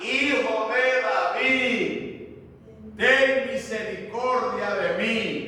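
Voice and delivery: slow, drawn-out imitated cry; clamor insistente imitado. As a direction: cry out for mercy, stretching each word. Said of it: "él clamaba mucho más". (clamor prolongado e insistente, imitado)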